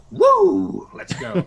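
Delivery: high whooping cheer then breathy go; excited whoop. Retold or imitated. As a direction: whoop like a rush just hit. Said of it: "It's like".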